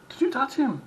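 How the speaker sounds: loud, high accusing question; retold confrontation. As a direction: demand an answer from the worker.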